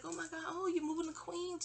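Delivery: high gushing congratulations; lightly played delighted neighbor; mock excited neighbor. Neighbor congratulating Mommy on move, high mock gushing.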